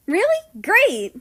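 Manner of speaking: high, loud, delighted cheer. A narrator's reading. America cheering to his brother about dinner. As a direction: burst out with joy. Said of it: "America cheered".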